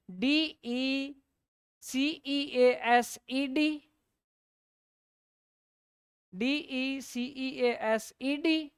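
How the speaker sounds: letters spelled slowly one by one, D-E-C-E-A-S-E-D; spelling aloud. Shown as a demonstration. Teacher dictating the spelling slowly to the class. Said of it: "ई मैंने कहा रहेगा और D जुड़ेगा".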